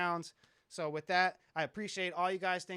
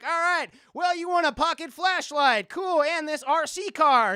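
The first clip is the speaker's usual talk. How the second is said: hammed-up host voice, higher and louder; mock game show host; 9 semitones higher, 10 dB louder, slower than usual. Streamer mocking MTV hosts handing out prizes, hammed-up announcer voice.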